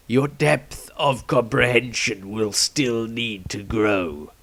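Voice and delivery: slow and grave, handing down a ruling; aged Patriarch. Acted. Patriarch voiced, granting permission.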